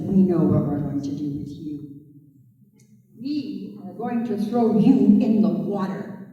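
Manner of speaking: loom over the little turtle; menacing men. A narrator's reading. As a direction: captors threatening the turtle in a low loud voice.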